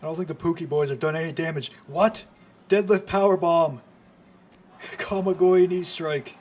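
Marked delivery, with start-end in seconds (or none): 1.9-6.4 real astonishment; excited disbelief. Real, not acted. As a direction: shout each move name. Rapid moves called live.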